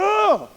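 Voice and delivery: high, loud exasperated "ugh"; fed-up groan. Retold or imitated. Preacher mimics a fed-up believer with a loud groan. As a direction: let out a sharp frustrated groan. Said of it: "you're just like".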